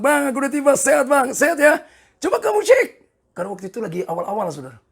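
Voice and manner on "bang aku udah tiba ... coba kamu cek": high, loud imitation of an eager caller; excited retelling. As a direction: act out a cheerful check-in, voice raised. Retelling a happy phone call home. (tiruan suara bersemangat, tinggi dan lantang)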